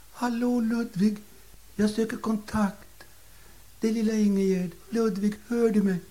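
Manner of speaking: put-on a little old lady calls her late husband's spirit; calling to the dead. Old lady coaxing her dead husband's spirit to answer, softly and high. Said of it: "Hon sa".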